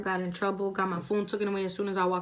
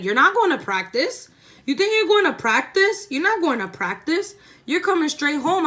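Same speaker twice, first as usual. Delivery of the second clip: higher, louder scolding refusal; mock angry mom; 7 semitones higher, 5 dB louder than usual. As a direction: play mom shutting her down.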